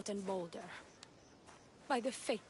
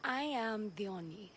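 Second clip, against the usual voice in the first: ordinary talk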